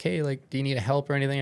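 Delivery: shouting to a swimmer far off, quick and urgent; calling out. Retold or imitated. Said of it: "I'm now calling him".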